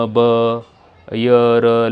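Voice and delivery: ordinary talk, nothing marked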